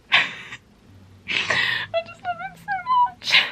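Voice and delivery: very high, drawn-out tearful squeal. Real, not acted. Reader overcome with love for Caleb, tearful. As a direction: squeak it out slowly through rising tears. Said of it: "I'm gonna cry".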